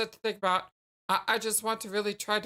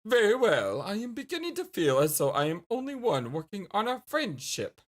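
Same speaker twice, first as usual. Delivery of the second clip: ordinary delivery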